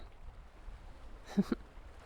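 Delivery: breathy, amused little laugh; soft villain chuckle. Acted. Witch amused by the blushing princess, soft chuckle. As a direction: chuckle softly as if pleased with yourself.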